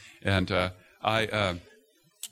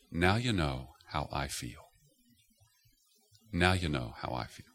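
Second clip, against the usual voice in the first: low, soft voice from heaven; God answering the fuming father, low and soft; 8 semitones lower, 6 dB quieter than usual. Retold or imitated. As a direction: speak low and gently as God. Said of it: "a voice speak from heaven".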